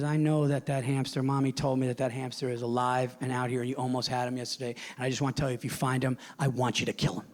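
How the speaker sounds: a hint of a cold little mob boss; menacing daughter. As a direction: murmur it low like a tiny mob boss. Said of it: "She goes".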